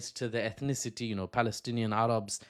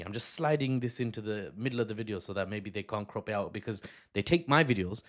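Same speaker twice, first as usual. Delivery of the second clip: ordinary talk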